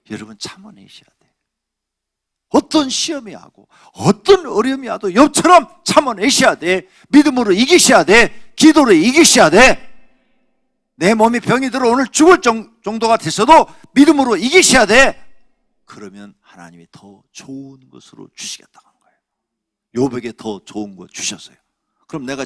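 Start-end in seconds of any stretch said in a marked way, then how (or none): 2.5-15.2 loud, high fiery exhortation; preaching at full force. Acted. 15.8-20.1 whisper. Acted. Drops after loud exhortation.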